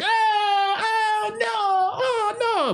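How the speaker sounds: high-pitched hammed-up crying out; horrified wail. Butcher drenched in goat urine, hammed-up horrified wail. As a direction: wail it high, drawn out in mock horror.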